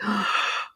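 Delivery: soft sharp intake of breath; hushed gasp of being overcome; moved gasp. Retold or imitated. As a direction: imitate a quiet, touched gasp. Retelling reaction to sister's yearbook message.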